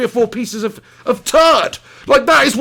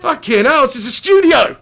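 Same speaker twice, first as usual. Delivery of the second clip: exasperated outburst; 5 semitones higher, 4 dB louder than usual. Real, not acted. Exasperated at partner's noise.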